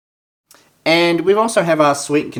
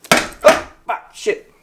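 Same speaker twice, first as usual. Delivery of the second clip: startled cursing; 7 semitones higher, much slower than usual. Real, not acted. Can spraying while opening.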